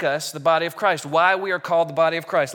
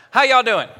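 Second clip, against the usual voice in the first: louder, higher shout to the crowd; shouted greeting; 5 semitones higher, 7 dB louder than usual. Real, not acted. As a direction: shout it out to win the room. Calls out to the other half.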